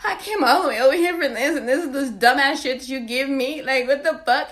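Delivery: mock furious rant; annoyed rant. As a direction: let him have it. Angry at him afterward.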